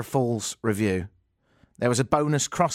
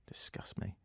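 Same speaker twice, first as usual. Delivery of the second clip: very soft whisper; barely audible mock-appalled aside; whispered mock self-disgust; 19 dB quieter than usual. Mock self-disgust under his breath.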